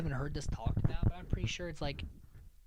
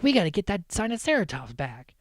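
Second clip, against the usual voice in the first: raised, urgent retold call; mock urgency; 6 semitones higher, 4 dB louder than usual. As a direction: act out an eager rallying call. Acted urgent call to chase dinosaur.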